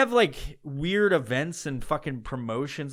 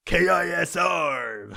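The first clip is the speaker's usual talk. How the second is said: slow, louder shouted station call; radio DJ voice; 4 semitones higher, 5 dB louder, much slower than usual. Retold or imitated. Mocking the KISR DJ, slow loud shouted station call. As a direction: belt the letters like a DJ ident. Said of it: "this weird fucking voice that they all do".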